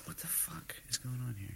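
annoyed at the computer, real whispered aside; frustrated whisper. Real, not acted. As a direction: hiss it aside so the mic barely catches it. Computer acting up mid-sentence.

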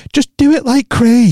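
high, drawn-out American impression; American comedian's accent. Quoting a comedian's bit about a new boyfriend, in mock American accent. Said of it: "her accent".

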